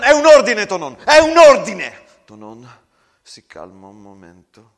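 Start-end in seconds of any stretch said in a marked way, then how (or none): 0.0-2.1 very loud shouted order; furious captain bellowing the order; commanding shout. Acted. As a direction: roar the order to keep him down. Captain commands him to stay.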